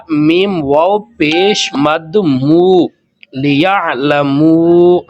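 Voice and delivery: لیعلمو with the long mu held very slowly; held madd on لیعلمو; slow madd demo. Shown as a demonstration. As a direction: stretch the final مو in لیعلمو. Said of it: "اس کو کہتے ہیں مد منفصل".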